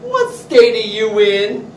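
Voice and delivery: loud, slow sarcastic question; sassy retort. Retold or imitated. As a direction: needle her with mock disbelief. Owner retorting to customer.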